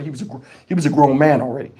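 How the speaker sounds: ordinary talk, nothing marked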